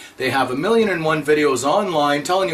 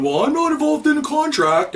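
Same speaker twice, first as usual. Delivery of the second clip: much higher put-on squeaky parody voice; high mocking voice; 11 semitones higher than usual. Parodying contract deniers to his viewers, high mocking squeak. Mocking people he calls terrorists.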